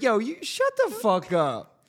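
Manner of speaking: high-pitched, drawn-out mock retort; hypothetical outburst. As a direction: throw it out high and slow, as if provoked. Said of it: "And you'd be like".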